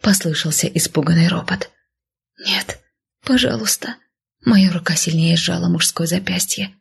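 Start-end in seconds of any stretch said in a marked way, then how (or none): 2.4-4.0 мольба. A narrator's reading. Героиня умоляет в страхе.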